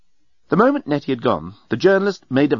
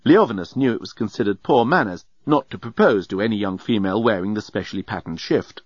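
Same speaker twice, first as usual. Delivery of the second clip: ordinary delivery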